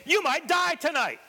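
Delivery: high, hammed-up bedtime threat; mock scaring. Parent scaring a child before sleep, mock-menacing. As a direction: pitch it way up to scare in jest. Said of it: "Nothing like scaring your child before putting them to sleep".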